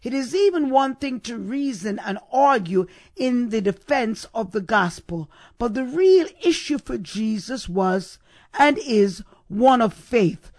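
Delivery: ordinary delivery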